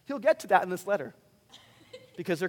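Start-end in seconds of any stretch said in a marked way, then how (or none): none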